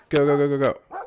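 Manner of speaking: loud, rapid cheering on; excited urging. Real, not acted. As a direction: urge them on, loud and eager. Urging characters on in game.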